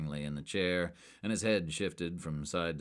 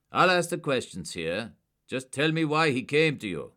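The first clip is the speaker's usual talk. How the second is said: loud, higher and curt; hard command; 8 semitones higher, 9 dB louder than usual. A narrator's reading. Agent asserting control over a suspect, hard. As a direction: take control.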